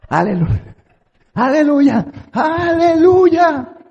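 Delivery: soaring high, drawn-out aleluya, rising each time; mocking the sister. Preacher mimics the flag-waving sister shouting praise. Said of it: "esa hermana me daba miedo".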